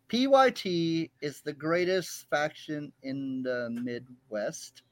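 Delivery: slow, grudging recital; dragging forced praise, sinking at the end; not meant. Real, not acted. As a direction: read it out at a crawl, against your will. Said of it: "That didn't seem like you mean".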